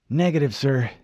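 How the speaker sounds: ordinary delivery, nothing marked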